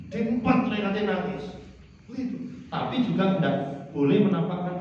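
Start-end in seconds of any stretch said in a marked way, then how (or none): none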